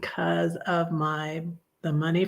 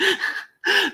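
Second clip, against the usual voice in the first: laughing; 14 semitones higher than usual. Real, not acted. Laughs at own remark.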